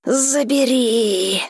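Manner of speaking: slow otherworldly dragon demand. A narrator's reading. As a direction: drag out each word like a beast.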